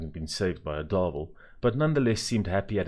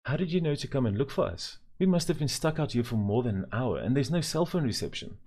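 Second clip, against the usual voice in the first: a hint of a worried mother, higher; mother asking anxiously; lightly voiced mother; 6 semitones higher than usual. A narrator's reading. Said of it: "said Mother".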